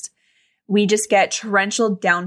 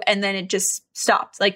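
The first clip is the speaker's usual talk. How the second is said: ordinary talk, nothing marked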